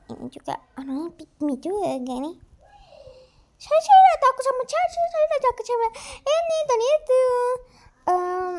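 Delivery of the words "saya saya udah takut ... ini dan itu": high put-on voice.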